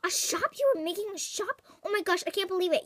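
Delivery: high, rushed disbelief; amazed gasp. Acted. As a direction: gasp and gush, fast. Amazed at news of shop.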